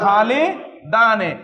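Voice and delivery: খালিদানে stretched out slowly; teacher models খালিদানে slowly, syllable by syllable; slow model word. Shown as a demonstration. Teacher shows students the dual of Khaled slowly. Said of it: "আপনি বলেন খালেদ".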